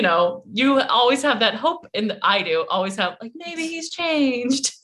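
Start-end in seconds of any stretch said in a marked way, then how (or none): 3.4-4.7 slow, higher, dreamy self-mocking tone; mock hopeful. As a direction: play your own naive hope, drawn out. Like an idiot.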